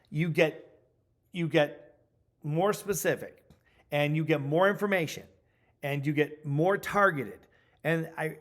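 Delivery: ordinary talk, nothing marked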